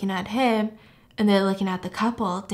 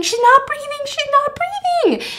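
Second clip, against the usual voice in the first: very high, loud panicked scream; 5 dB louder than usual. Retold or imitated. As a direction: shriek that she's stopped breathing. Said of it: "someone starts screaming".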